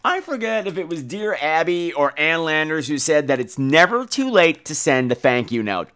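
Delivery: usual speaking voice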